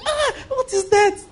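high, laughing mock gasp what is that; startled onlooker. Preacher mimics people gawking at a beggar, shocked and laughing. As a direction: play the shocked passerby, laughing. Imitates onlookers staring at sufferer.

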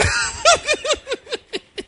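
high peals of laughter; hearty laugh. Real, not acted. Preacher amused by his own joke, high laughter.

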